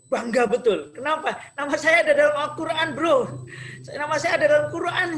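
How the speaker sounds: high, loud, rushing boast; excited pride. Retold or imitated. Young man finding his name in Quran, excited retelling. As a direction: burst out with thrilled pride. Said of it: "saya sangat excited waktu itu".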